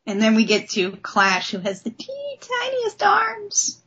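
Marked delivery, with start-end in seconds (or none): 1.9-3.8 higher, slow cooing over tiny arms; cutesy gush. Real, not acted. Fan cooing over a character's tiny arms, cutesy and slow. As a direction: coo it like something adorable.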